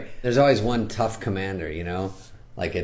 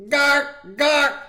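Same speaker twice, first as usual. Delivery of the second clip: high strangled gark; strangled squawk; 4 dB louder than usual. Acted. Maverick caught off guard, high strangled squawk. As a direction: squawk it out, choked. Odd choking noise in scene.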